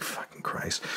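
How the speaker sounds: breathless whispered aside; whispered curse. Retold or imitated. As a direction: mutter it under your breath, unvoiced. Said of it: "And I'm like".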